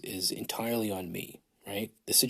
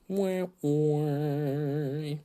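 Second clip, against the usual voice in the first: higher, drawn-out mock wailing; mock crybaby wail; 6 semitones higher, 3 dB louder than usual. Host mocking his complaints about a free trip, mock wailing. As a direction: fake a whiny baby's wah-wah.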